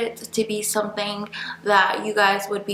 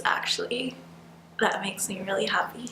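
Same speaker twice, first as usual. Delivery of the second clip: whispering; 8 dB quieter than usual. Real, not acted. Happy aside under her breath.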